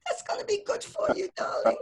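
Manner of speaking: worried Yiddish mama, building to cries; yiddish accent. Acted.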